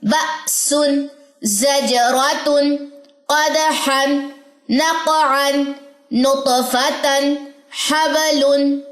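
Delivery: each word drawn out very slowly with qalqala; slow recitation. Shown as a demonstration. Tutor reads the whole word list together, very slow. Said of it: "एक साथ पढ़िए".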